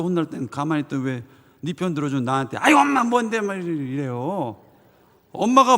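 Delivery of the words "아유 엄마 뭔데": high, mock sulky child retort; bratty snap. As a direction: mimic a sulky son lashing out. Son snaps at mother, retold.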